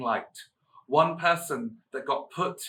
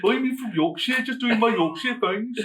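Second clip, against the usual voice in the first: a hammed-up Yorkshire accent, pitched higher; 8 semitones higher, 4 dB louder than usual. Co-host mimicking Ridge Holland's gimmick, in a higher bad Yorkshire accent. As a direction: do a bad Yorkshire rugby man, jolly and proud. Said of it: "That still wasn't Yorkshire".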